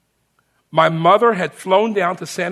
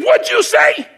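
a shocked yell, much higher and louder; stunned shout; 7 dB louder than usual. Retold or imitated. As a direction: shout it back in disbelief. Sergeant stunned by answer.

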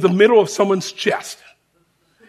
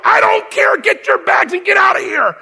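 much higher, louder barked orders; imitated drill shout; 11 semitones higher, 4 dB louder than usual. Sergeant ordering recruit out at night, imitated shouting. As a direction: shout him out the door.

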